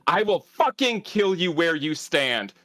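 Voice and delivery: furious scream. Acted. Lily slams the man against the bars, furious screaming threats. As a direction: scream it at him like you mean every word.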